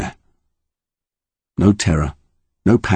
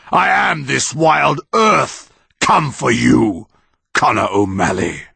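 slow, higher, looming pronouncement; menacing monster; 7 semitones higher, slower than usual. Acted. Monster bringing Connor to its eye, slow menace. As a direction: loom close and lay down each word.

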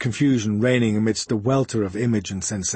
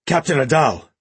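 louder, choked and breaking with fear; fearful call; 4 semitones higher, 5 dB louder than usual. Acted. Sergeant shouting for his captain, choked with fear. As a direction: cry out for the captain in panic. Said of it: "voice choked and broken by fear".

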